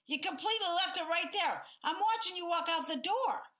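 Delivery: hammered-up blaming rant, pitch climbing; attacking tone. Shown as a demonstration. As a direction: go after him, building as you go. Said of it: "That tone that I had is attacking".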